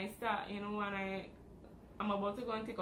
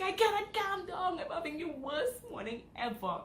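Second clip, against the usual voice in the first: overwhelmed protest; 8 semitones higher, 4 dB louder than usual. Retold or imitated. Answering mom, overwhelmed.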